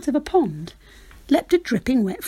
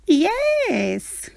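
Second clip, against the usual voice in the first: high, bright witch exclaiming; a quick delighted yes; the witch welcoming the dog aboard; witch crying yes; 9 semitones higher than usual. Acted. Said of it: "cried the witch".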